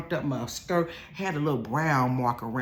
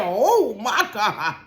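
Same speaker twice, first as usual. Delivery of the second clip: laughing oh my God; 7 semitones higher, 6 dB louder, much slower than usual. Retold or imitated. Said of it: "I said".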